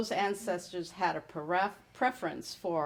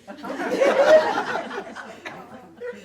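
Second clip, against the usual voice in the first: high, loud burst of real laughter; a real, hearty laugh; 7 dB louder than usual. Real, not acted. Laughs at sister's stubborn claim.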